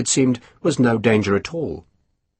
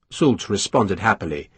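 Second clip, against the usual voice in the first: usual speaking voice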